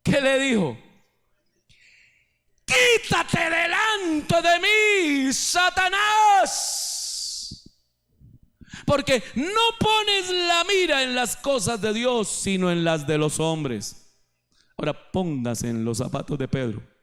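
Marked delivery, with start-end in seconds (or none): 2.7-14.0 slow, high, shouted rebuke; stern rebuke. Acted. Jesus rebuking Peter before the disciples, a slow high shout.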